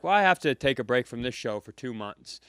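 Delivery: usual speaking voice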